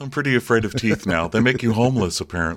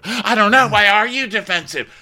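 high, loud mock comeback; mock retort; 10 semitones higher, 5 dB louder than usual. Retelling the defensiveness quarrel, loud mock comeback. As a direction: fire it back high and loud, hammed up.

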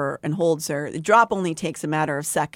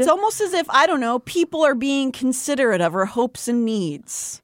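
sarcastic; 7 semitones higher than usual. Pretend. Sarcastic remark.

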